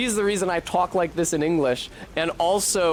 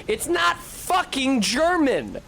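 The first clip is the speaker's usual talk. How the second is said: much higher, slower exasperated cry; real exasperated outburst; 11 semitones higher, slower than usual. Real, not acted. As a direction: burst out in real indignation. Ranting about Low German.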